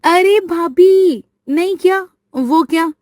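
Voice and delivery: put-on teasing young girl. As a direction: playfully nudge the shy bride along. Sheetal teases her sister-in-law. (ऊँची, चंचल ननद की आवाज़)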